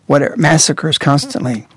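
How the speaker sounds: ordinary talk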